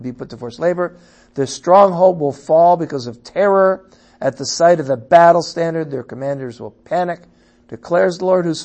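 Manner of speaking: loud, high proclaiming of the prophecy; forceful scripture reading. Acted. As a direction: cry it out to the people.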